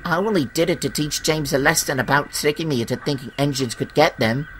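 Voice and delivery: high little engine voice; confessing little engine. Acted. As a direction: explain yourself in a high voice. Percy confesses to the controller.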